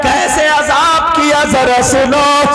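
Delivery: high, loud preacher's cry, drawn out; fiery preaching. Acted. Preacher warning the girls about disobedience, full force.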